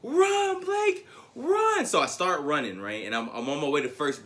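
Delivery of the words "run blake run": put-on high shouted cheer of kids; teammates yelling. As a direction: call it out like little teammates. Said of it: "my teammates are like".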